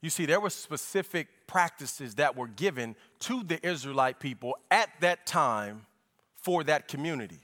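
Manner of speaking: ordinary delivery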